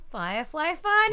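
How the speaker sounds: high, shouted show title; mock show announcement. Host introduces their favourite show, mock announcer shout. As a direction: call it out like a hammed-up kids' show host.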